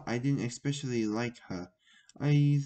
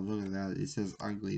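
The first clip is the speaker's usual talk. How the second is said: ordinary delivery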